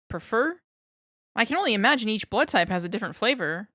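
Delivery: joking to break the tension; awkward babbling scientist. Acted. Darling babbles awkward joke.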